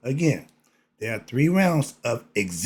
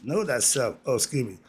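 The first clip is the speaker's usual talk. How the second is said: normal talking voice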